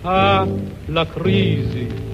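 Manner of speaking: ordinary talk, nothing marked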